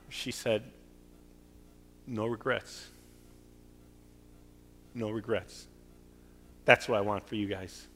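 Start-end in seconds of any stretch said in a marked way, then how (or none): 2.1-5.7 put-on soft, slight German accent on "No regrets"; a hint of the wife's German accent, softly; lightly imitated German accent. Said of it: "German accent".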